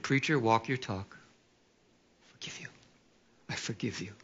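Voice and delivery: soft, hushed inner voice; quiet inner resolve. Retold or imitated. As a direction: say it quietly to yourself. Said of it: "in my mind, I went".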